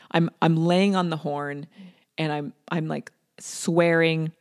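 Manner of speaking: normal talking voice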